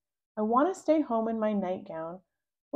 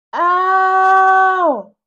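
very loud high yelp of pain; pained scream; 10 semitones higher, 15 dB louder than usual. Acted. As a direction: shriek at the needle prick. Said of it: "I scream and cry".

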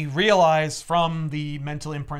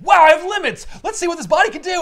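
high, loud burst of wonder; hammed-up thrill of discovery; excited amazement; 14 semitones higher, 9 dB louder than usual. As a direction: marvel loudly at a brand-new body. Newborn Beyonder marvels at his body.

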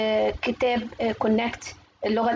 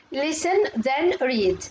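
slow, word-by-word teacher delivery; slow model reading; much slower than usual. Shown as a demonstration. Teacher reads the exercise heading to young learners, slow modelling. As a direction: space out each word for learners.

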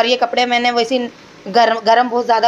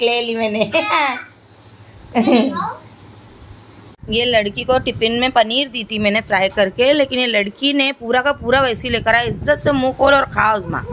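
normal talking voice

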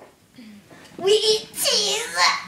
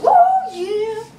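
ordinary talk

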